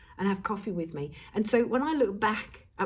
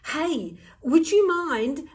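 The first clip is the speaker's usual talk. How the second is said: wheedling ask; 5 semitones higher, 6 dB louder, much slower than usual. Acted. Acting wheedling favour request.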